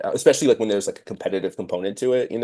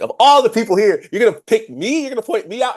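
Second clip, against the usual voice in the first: high offended outrage; 13 semitones higher, 5 dB louder than usual. Retold or imitated. Retold offended reaction.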